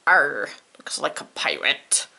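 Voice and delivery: low, growly-sounding pirate grunt, mock tough. Teacher voices a pirate character.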